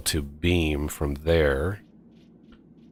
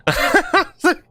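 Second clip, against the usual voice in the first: laughing; 6 dB louder than usual. Real, not acted. Laughing at friend hiding behind.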